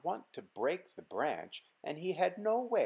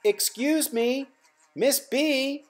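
louder, higher, slow call; speaking louder; 12 semitones higher, 8 dB louder, slower than usual. Acted. Simon trying to get the busy bee's notice, louder. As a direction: raise the voice to get attention. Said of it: "Simon spoke louder".

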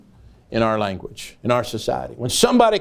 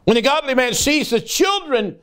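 high, fervent preaching; preaching with fire; 8 semitones higher than usual. Acted. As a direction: build the sermon to a fervent pitch. Preaching at full force.

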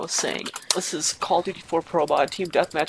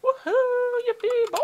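much higher squealing whoop; mock cheering. Gamer cheering wildly, much higher giddy whoop.

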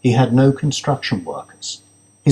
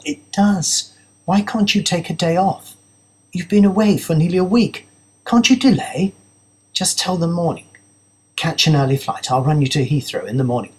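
a higher, pleading voice for the fiancée; disappointed partner holding her plans together; hint of a hurt fiancée, higher; lightly voiced fiancée; 6 semitones higher than usual. Narrator voices Zara.

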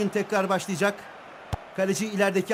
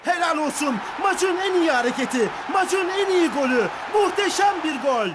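screaming football commentator; 7 semitones higher than usual. Acted. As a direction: scream the praise. Goal celebration commentary.

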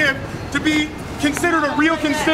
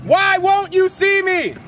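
high, drawn-out demanding call; pleading, accusing holler; 3 semitones higher, slower than usual. Real, not acted. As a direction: call out slowly to someone who won't come. Protester calling out absent legislator.

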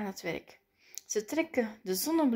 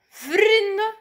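high, loud, stretched call; big proclaiming shout; announcing; 10 semitones higher, 8 dB louder than usual. A narrator's reading. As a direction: hail the crowd like a herald. Announcer calls out to crowd.

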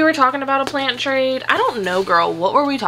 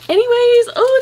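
excited; 6 dB louder than usual. Real, not acted. Loud high transition word.

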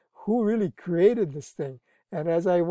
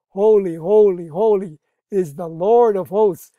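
preaching scripture; 8 dB louder than usual. Acted. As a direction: ring it out like a preacher reading Isaiah. Angels' cry quoted.

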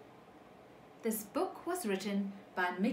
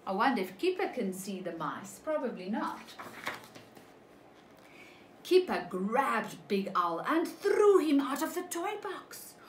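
ordinary delivery, nothing marked

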